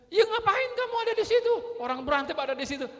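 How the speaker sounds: high, loud angry retort; marah menyalahkan. Acted. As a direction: parent yelling down the child's excuse. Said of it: "kalau marah tuh begitu". (bentakan tinggi orang tua yang ngotot)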